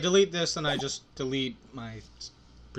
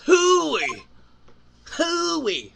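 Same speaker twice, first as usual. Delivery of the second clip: high falling Western holler; cowboy whoop; 9 dB louder than usual. Acted. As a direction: whoop high, then swoop down. Playing the cowboy lawman, whooping.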